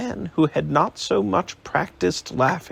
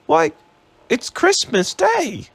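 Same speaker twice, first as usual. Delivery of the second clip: put-on boy voice; 11 semitones higher, 3 dB louder than usual. Boy replying.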